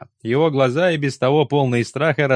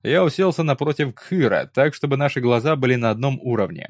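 plain reading voice

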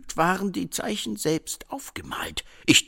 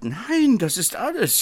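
loud, high no, then backing off; startled protest; flustered outburst; 7 semitones higher, 8 dB louder than usual. Acted. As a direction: blurt the no in dismay. Assistant flustered by teasing.

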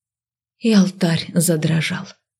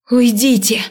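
high hoarse cry; strained, hoarse call; hoarse shout; 5 semitones higher than usual. A narrator's reading. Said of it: "Голос мой охрип". (охрипший крик)

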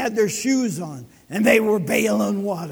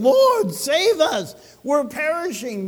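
urgent disciples' cry; 5 semitones higher, 5 dB louder than usual. A narrator's reading. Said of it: "Notice the exclamation points".

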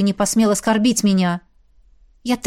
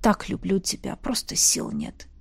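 soft, low, hushed confession; barely voiced declaration of love; loving whisper; 4 semitones lower, 8 dB quieter than usual. A narrator's reading. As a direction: whisper it close, with tenderness. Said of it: "прошептал".

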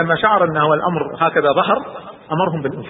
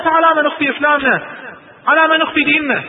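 much higher, louder insistent question; urgent protest; 12 semitones higher, 4 dB louder than usual. Acted. Omar urges the Prophet to stop hiding, loud. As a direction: press the point hard.

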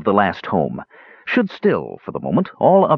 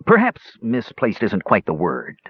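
plain reading voice

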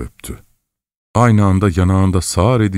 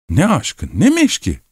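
sharply raised pitch, genuine indignation; indignant outburst; 8 semitones higher than usual. A narrator's reading. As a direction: cry it out, offended and angry. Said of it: "diye bağırdı Katerina Lvovna samimi bir hiddetle".

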